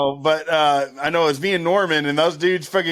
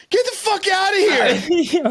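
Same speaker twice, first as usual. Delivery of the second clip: high, slow, laughing mock shout; laughing yell; 12 semitones higher, slower than usual. Telling a stranger to leave the room, laughing shout. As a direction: drag out the yell while laughing. Chick standing by the door.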